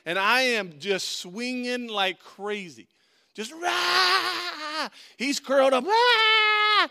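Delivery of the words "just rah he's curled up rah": high, loud crying roar; wild fight yell. Retold or imitated. Preacher reliving swinging at the bully, high crying yell. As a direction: let rip a frantic battle cry.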